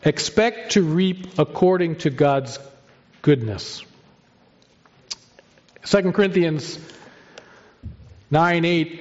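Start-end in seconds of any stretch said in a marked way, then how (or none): none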